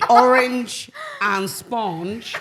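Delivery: orange and sponge stretched very slowly so orange sounds like sponge; rhyme demonstration. Shown as a demonstration. Host proves the rhyme to co-hosts, slow orange and sponge. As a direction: draw out orange and sponge as a rhyming pair. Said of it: "with an accent".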